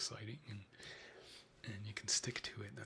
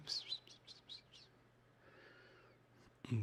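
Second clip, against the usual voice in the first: whispered psst cat call; 5 semitones higher than usual. Real, not acted. Calling cats.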